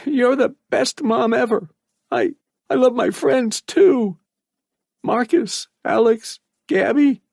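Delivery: put-on boy crying as he says goodbye to his mom; tearful farewell. A narrator's reading. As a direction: say goodbye to everyone through tears. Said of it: "He sniffled and groaned".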